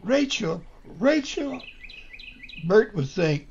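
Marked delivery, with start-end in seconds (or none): 0.0-1.6 much higher joyful squeal; excited screaming. A narrator's reading. The women seeing Rachel return, screaming with joy. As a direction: shriek her name with delight. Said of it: "Women were screaming".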